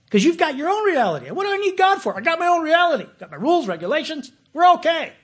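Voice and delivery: mock the self-satisfied believer; mock smug pride. Mocking a proud religious person.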